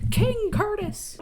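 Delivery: slow, high cheer. Real, not acted. Viewer cheering Lizzie on, high and slow. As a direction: hype it up. Celebrating line in recap.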